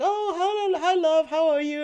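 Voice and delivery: cartoonish British lady greeting in a piping falsetto. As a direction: put on a squeaky British woman's accent. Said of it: "in her British accent".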